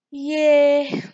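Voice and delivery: loud high cheer; a loud, bright yay; happy yay. A narrator's reading. Chip cheers then complains.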